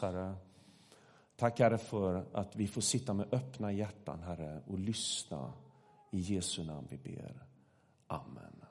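soft, low spoken prayer. Real, not acted. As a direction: pray softly and reverently, voice sinking to the Amen. Leads the congregation in prayer.

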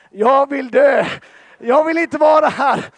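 high, loud mock anguished shout; mock despairing cry. Pastor relives his shame at the finish line, shouting in mock despair. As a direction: cry out your embarrassment for laughs.

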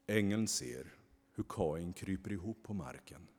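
ordinary delivery

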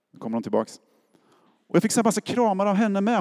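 normal talking voice

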